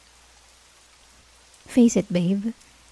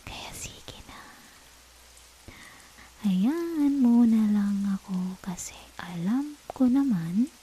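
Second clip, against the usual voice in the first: slow seductive whisper; 8 dB quieter than usual. Acted. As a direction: coax softly in a hush.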